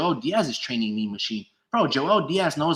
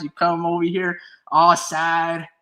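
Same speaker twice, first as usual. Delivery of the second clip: usual speaking voice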